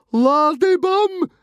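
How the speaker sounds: very high, loud, drawn-out squeal; shouted insult. A narrator's reading. Fishlegs taunting Stoic the Vast, shouting. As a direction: shout the insult slowly at screeching pitch. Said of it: "shouted Fishlegs".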